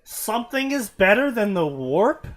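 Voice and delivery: loud, high, mock amazement; mock surprise. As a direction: gasp it out in fake disbelief. Mock shock at better than warp.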